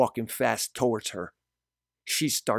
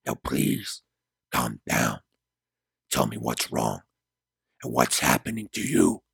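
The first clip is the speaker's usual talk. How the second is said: deep, low-pitched male character calming someone; reassuring Gabriel; 11 semitones lower than usual. A narrator's reading. Gabriel covers Mari's mouth to calm her, deep reassurance. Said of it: "Gabriel quickly covers her mouth and holds her head tight and says".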